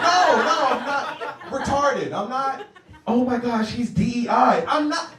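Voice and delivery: high, loud frantic denial; flustered protest. Retold or imitated. Comic at work denying he's slow. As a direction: protest too much, flustered.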